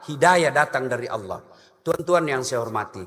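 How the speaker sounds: normal talking voice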